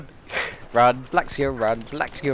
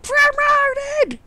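very high, loud comic squawk; mock promotion yell; 7 dB louder than usual. Voicing what a spotted player is thinking, high mock yell. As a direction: screech it like an over-the-top game celebration. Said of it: "why is everyone yelling when they get promoted".

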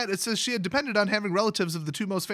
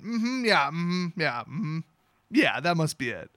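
sarcastic; 3 semitones lower, slower than usual. Real, not acted. Sarcastic agreement about haircut.

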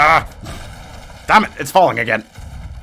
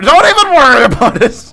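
higher, louder mock-calm reassurance; 12 semitones higher, 9 dB louder than usual. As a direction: belt it out like all is fine. Mock reassurance amid chaos.

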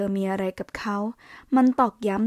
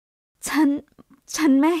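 stunned stammer; 6 semitones higher, 3 dB louder, much slower than usual. A narrator's reading. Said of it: "อ้าปากค้างอย่างตะลึงงัน".

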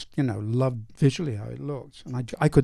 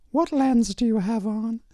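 high, slow mock questioning; imitated demanding actress; 9 semitones higher than usual. Director imitates actress quizzing cameraman, high mock interrogation. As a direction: draw it out high, mimicking the star.

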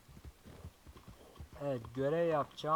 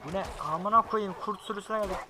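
alarmed; 7 semitones higher, 8 dB louder than usual. Real, not acted. Ambushed by wolf pack.

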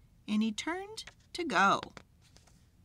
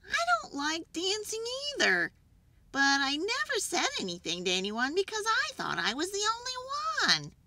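high, confessing buffalo voice; high-pitched animal confession; Water Buffalo; 13 semitones higher, faster than usual. A narrator's reading. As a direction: own up in a raised character voice. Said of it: "said Water Buffalo".

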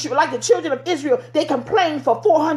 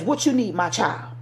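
tender voice of God; 5 semitones lower, 4 dB quieter than usual. Acted.

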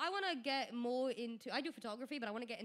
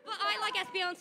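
insisting; 8 semitones higher, 5 dB louder than usual. Real, not acted. Insisting again on favorite.

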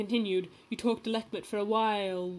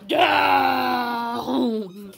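shout; 3 semitones higher, 7 dB louder than usual. Real, not acted. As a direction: yell it out laughing. Shouted joke about dipping.

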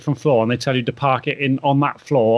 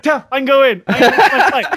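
high, laughing, mock-defiant; defiant laughing retort; 10 semitones higher, 5 dB louder than usual. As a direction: laugh it off and leave them standing, raised pitch. Defiant retort while running off.

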